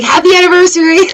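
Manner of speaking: high, quick mock cheer; hammed-up high voice on the verge of laughter; playful gushing greeting. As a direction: chirp it quickly as a joke. Jokey quoted greeting, bursts into laughter.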